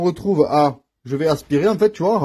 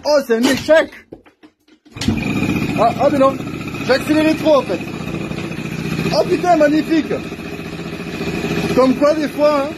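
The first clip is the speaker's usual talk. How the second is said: higher, excited calls over engine noise; raised voice over engine; 8 semitones higher than usual. Real, not acted. Mechanic reacting to the started scooter, raised voice. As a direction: call it out above the running motor.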